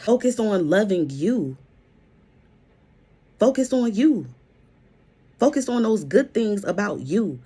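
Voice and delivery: God speaking straight to the listener, preached with force; voicing God. Preacher voicing God to a heartbroken listener, pleading and forceful. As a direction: speak as God urging them to love themselves. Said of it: "That's what God is saying".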